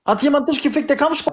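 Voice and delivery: high, heated taunting; shouting down a friend; angry. Real, not acted. Heated insult quarrel.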